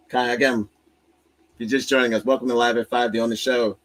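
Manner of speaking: show host intro. Acted. Welcoming viewers to Live at Five as a show host.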